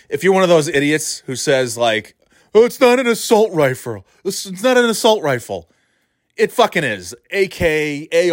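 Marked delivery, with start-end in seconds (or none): 2.5-5.7 higher-pitched mimicry of a nitpicker; mocking idiot pedant. Host imitating idiots who argue over rifle names, mocking. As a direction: parrot the objection mockingly.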